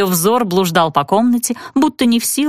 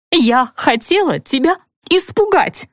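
a thin, slightly higher little outcry, a bit slow; thin cry; 4 semitones higher than usual. A narrator's reading. Cousin cries out thinly to Serafima, confessing. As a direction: cry it out thinly, near tears. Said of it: "тоненько крикнула кузина".

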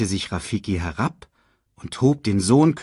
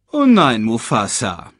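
growled retort; 3 semitones higher, 4 dB louder than usual. A narrator's reading. Scar answers the king's order back, growled retort. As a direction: growl back, dropping in pitch. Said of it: "knurrte Scar".

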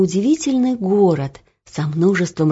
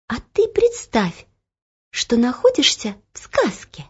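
ringing crystal chime voice; 5 semitones higher than usual. Acted. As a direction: coax her like a kind spell. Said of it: "ответил голос".

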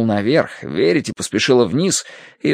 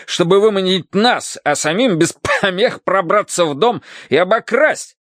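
higher, emphatic angry rant; spluttering outrage; 9 semitones higher than usual. A narrator's reading. Colonel accuses the visitors of plotting, spluttering. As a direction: rant about their scheme. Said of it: "забрызгал слюной полковник".

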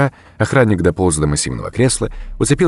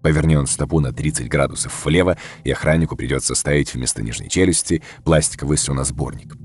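ordinary delivery, nothing marked

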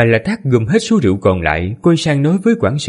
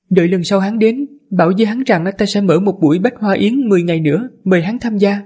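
high, composed lady's voice; man doing a woman's voice; 9 semitones higher than usual. Acted. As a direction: speak as the gracious mistress. Female tower mistress speaks.

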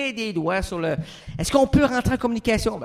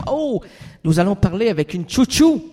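normal talking voice

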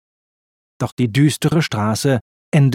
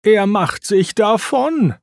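high, drawn-out mild groan of dismay; 8 semitones higher than usual. A narrator's reading. Racer locked out as the thief escapes, groaning. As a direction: groan it slowly, pitch raised. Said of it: "stöhnte Lightning".

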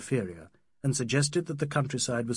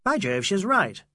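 higher, louder surprised agreement; exclaiming; 7 semitones higher, 5 dB louder than usual. A narrator's reading. Ronnie seeing that Adela is right, exclaiming. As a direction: exclaim it as a sudden realisation. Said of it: "Ronnie exclaimed".

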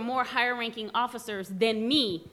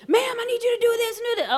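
mock officer; 12 semitones higher, 4 dB louder, faster than usual.

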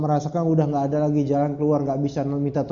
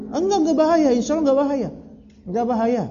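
emphatic denial; 9 semitones higher, faster than usual. Real, not acted. Rebutting objection emphatically.

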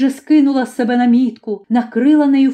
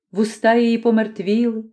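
ordinary delivery, nothing marked

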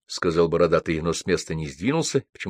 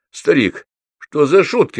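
higher, louder hurt protest; offended; 9 semitones higher, 6 dB louder than usual. A narrator's reading. Байрон objects to being asked for money, offended. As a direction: protest as if insulted. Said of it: "обиделся Байрон".

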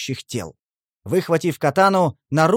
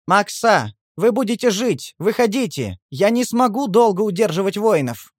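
high, pleading shout from outside; raised voice with a pitiful edge; plaintive calling; 6 semitones higher, 4 dB louder than usual. A narrator's reading. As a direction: urge them to come out, almost begging. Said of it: "Голос папа звучал как-то жалобно".

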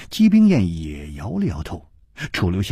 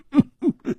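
hearty high laugh. Acted. Hu Tiehua laughs after speaking.

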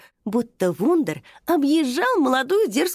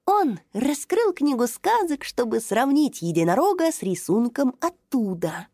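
ordinary delivery, nothing marked

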